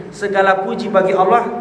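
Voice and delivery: normal talking voice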